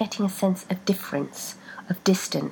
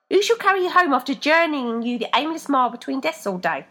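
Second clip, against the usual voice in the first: higher, louder, fast complaint; indignant foot voice; 7 semitones higher, 4 dB louder than usual. A narrator's reading. Right ranting at Rebecca, higher fast rant. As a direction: rant about the hard labour.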